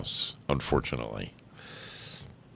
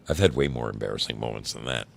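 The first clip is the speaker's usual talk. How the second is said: ordinary talk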